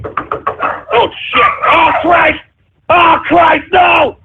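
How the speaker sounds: hammed-up panic; panicked shouting. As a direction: yell as if something is coming at you. Frightened by a prank call.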